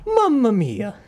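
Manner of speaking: high, falling Italian lament. Acted. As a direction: draw it out in mock Italian dismay. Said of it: "I'll just go for the stereotypical".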